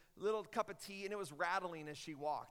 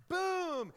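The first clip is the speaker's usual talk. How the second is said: loud, high "boom" of impact; booming kick noise; 10 semitones higher, 8 dB louder than usual. Retold or imitated. Preacher acting out the missionary's kick, booming loudly. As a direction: burst out a big imitated kick. Imitating the chair being kicked.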